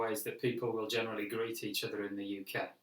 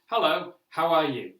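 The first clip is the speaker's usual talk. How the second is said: stiff, loud, learned-by-rote hello; louder textbook hello; robotic textbook greeting; 3 semitones higher, 8 dB louder than usual. Shown as a demonstration. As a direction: say it like a memorised drill. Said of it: "sound a little bit robotic".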